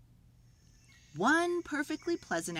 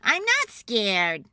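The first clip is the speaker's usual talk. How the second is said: insisting; 2 semitones higher, 8 dB louder, slower than usual. Acted. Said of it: "said Jim".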